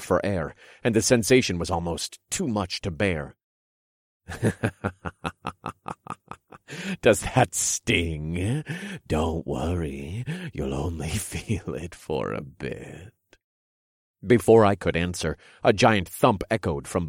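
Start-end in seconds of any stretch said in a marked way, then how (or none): 4.3-6.3 villain laugh. Acted. King laughs at victims. 7.0-13.1 sly villain king. Acted. King voiced in story.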